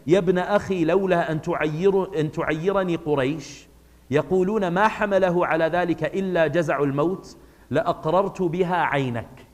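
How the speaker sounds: rattled off fast in Arabic; fast read of an Arabic passage before translating it; quick Arabic reading. Shown as a demonstration. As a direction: read the Arabic source at speed, close to recitation. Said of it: "I'm gonna read it in Arabic".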